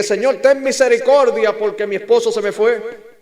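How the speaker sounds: loud, high imitation of begging; hammed-up cry for mercy; mock desperate pleading. Preacher imitates abandoned spouses praying, mock desperate pleading.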